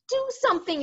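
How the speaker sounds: high urgent plea; girl cries out. A narrator's reading. Sophie begging the BFG, high and urgent. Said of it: "she cried".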